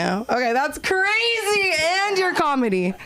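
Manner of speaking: ordinary talk, nothing marked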